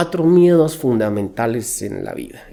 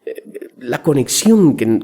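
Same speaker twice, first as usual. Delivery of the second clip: ordinary talk, nothing marked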